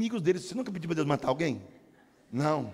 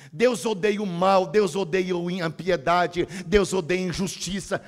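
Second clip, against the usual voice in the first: preaching; 4 dB louder than usual. Acted. Preaching God hates evil.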